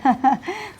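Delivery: short, self-satisfied laugh; smug chuckle. Acted. Haman chuckling as he assumes he'll be honoured. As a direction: chuckle at your own good luck.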